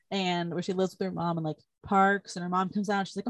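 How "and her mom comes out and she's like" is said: normal talking voice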